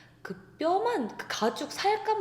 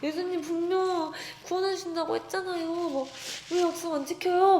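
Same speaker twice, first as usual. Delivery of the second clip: high, desperate pleading to Jesus; retold crying plea; 8 semitones higher than usual. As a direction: beg through tears, reliving the dream. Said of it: "내 옷을 막 찢으면서". (울면서 높게 애원하는 목소리)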